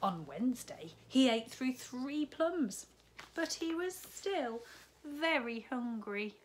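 put-on animated children's story narration; storybook read-aloud, building. A narrator's reading. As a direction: build the suspense for the little ones. Reads picture book to children.

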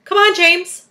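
loud, high acted encouragement; storybook coaxing call. A narrator's reading. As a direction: coax him brightly to speak up. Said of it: "coaxing him".

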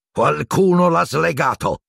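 high, slow angry snarl. A narrator's reading. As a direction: snarl it slowly with the pitch pushed up. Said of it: "ringhiò il boia". (ringhio rabbioso)

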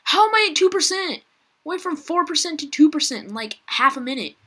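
high, loud disbelief; panicked high outcry. Real, not acted. Gamer shouting as the battery drops. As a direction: cry out at the dying battery.

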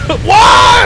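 very high, loud shout of disbelief; shocked outburst. Real, not acted. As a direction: yell out in sudden shock. Sudden shock during play.

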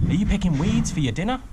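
high-pitched mocking call; taunting shout. A narrator's reading. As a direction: jeer at a rival across the water. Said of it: "he shouted".

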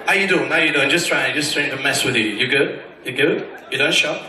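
lightly voiced American guy; mock American greeting. Imitating American greeting low.

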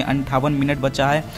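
ordinary talk

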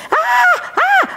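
mock child wailing, high pitched; imitating a toddler's tantrum cry.

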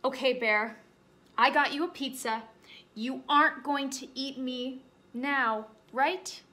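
rabbit anxious for reassurance; hopeful bunny. Acted.

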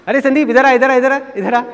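high-pitched imitation of an aunt summoning a child; mimicked auntie's repeated call. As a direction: call the boy over, high and insistent. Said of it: "मामी जी आवाज़ लगाती थी".

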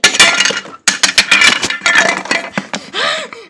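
loud panting and gasping; reenacted mad dash. Shown as a demonstration. Vlogger acting out running downstairs, loud gasps. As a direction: gasp frantically, acting out a sprint.

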